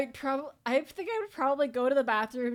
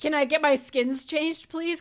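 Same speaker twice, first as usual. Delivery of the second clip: higher, louder pleading request; mock pleading; 4 semitones higher, 6 dB louder than usual. Employee begging the boss to change their skin, mock pleading. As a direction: put on an eager plea. Acting out pleading with game character.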